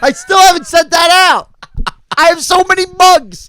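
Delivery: loud, high self-scolding yell; suddenly remembers he forgot, and yells; real panicked outburst. Real, not acted. Cohost forgot to send listener's box, yelling high and loud. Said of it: "Oh, fuck".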